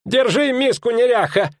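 very high scolding cry; high-pitched mock-angry innkeeper; innkeeper's shout. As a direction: scold her for all to hear. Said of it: "крикнул корчмарь".

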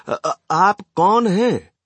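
put-on high, slow, halting old voice; elderly gentleman. A narrator's reading. Old man to the unknown woman, high halting question. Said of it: "एक वृद्ध महाशय ने पूछा".

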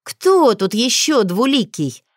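loud, slow high bark. Acted. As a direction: snap at him fiercely. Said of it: "натурально гавкать на Альфу".

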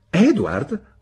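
high, loud summons of a name; indignant call. A narrator's reading. Spinster appealing to her brother, high and loud. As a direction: demand his attention. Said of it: "disse miss Marston".

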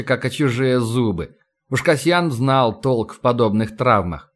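plain reading voice

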